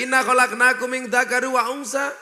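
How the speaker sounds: Quran recitation. Acted. Preacher reciting scripture before the crowd, chanted. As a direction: intone the verse like a preacher opening.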